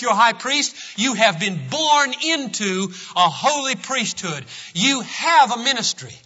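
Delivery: impassioned preacher; full-force preaching. Acted. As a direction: hammer it home to the pews.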